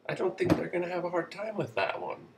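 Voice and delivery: ordinary talk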